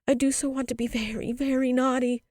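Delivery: put-on high, insistent child's wail; bitterly sobbed. A narrator's reading. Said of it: "the child sobbed more and more bitterly".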